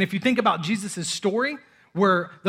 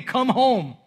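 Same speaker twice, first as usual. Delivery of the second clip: a louder, higher called-out plea; screaming; 5 semitones higher, 6 dB louder than usual. Pretend. God calling a lost child home, screamed plea. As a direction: call them home at full voice. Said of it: "he's screaming".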